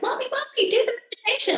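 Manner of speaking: put-on high, childlike pleading voice for mommy; imitating her little daughter. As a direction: mimic a seven-year-old begging for her bedtime meditation. Mother imitates young daughter pleading.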